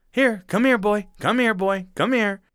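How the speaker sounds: high, loud call to a dog, fading a bit; sing-song here-boy call that softens as it goes. Retold or imitated. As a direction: mimic a neighbor calling his dog, crow-like. Crow mimicking neighbor calling dog.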